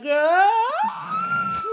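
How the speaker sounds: high startled shriek of disgust; sudden high-pitched scream; panicked scream. Retold or imitated. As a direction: yelp as if a fly went up your nose. Fly in her nose, screaming.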